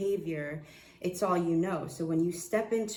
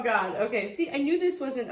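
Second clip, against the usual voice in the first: ordinary talk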